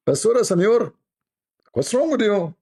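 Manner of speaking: high, indignant mock neighbor voice; imitating the neighbor. Retelling the neighbor's reply about garbage, high mock indignation. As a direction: squeak it out like the offended neighbor.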